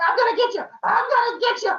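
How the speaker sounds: very high, loud, laughing threat; gleeful chase yell. Acted. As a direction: shriek it like a kid chasing. Cronus chasing father.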